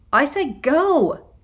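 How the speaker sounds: sharp, loud, high bark; barked order. A narrator's reading. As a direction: snap the order out slowly and loudly. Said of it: "she barked".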